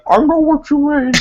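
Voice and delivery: squeaky high mouthful voice; mock high squeal. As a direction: pitch way up, muffled and smug. Mimicking girl stuffed with hot dog.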